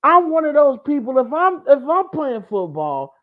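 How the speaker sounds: high-pitched incredulous rant; exasperated disbelief. Real, not acted. Host scolds a player who dropped the ball early, incredulous. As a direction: pitch it way up, baffled by the blunder.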